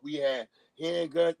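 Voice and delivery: normal talking voice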